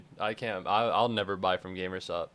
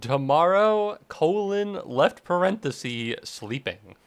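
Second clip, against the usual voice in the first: reading dad's text; 6 semitones higher, 5 dB louder, slower than usual. Retold or imitated. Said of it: "And he said".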